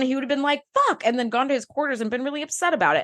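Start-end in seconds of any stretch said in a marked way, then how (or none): 0.7-1.1 a sharp, very high curse; frustrated curse. Retold or imitated. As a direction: let it burst out high. Wesley's frustrated outburst, retold.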